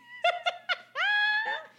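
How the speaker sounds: high, loud cackle; loud squealing laughter; cackling laugh. Real, not acted. Laughing at their own joke.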